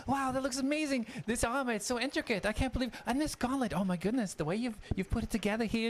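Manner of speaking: high, gushing admiration; high-pitched apprentice, thrilled by the armor; Jonas, delighted. Acted. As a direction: marvel at the craftsmanship.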